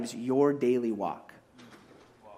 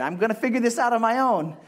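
put-on much higher, louder child's shriek of no; toddler refusing; 7 semitones higher, 4 dB louder, faster than usual. Daughter refusing a parent's help, high and shrill. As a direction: squeal like a furious toddler. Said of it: "is what she's saying".

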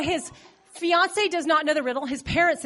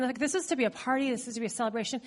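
ordinary talk

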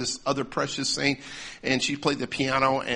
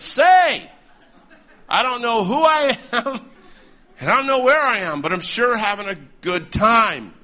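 put-on elderly heckler; 7 semitones higher, 7 dB louder than usual. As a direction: mimic the old lady's loud, high outburst. Old lady interrupts again, acted out.

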